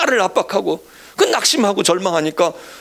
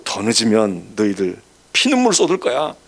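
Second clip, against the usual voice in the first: mock threat; 8 semitones lower than usual. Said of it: "위협 비슷하게 막 강조해요".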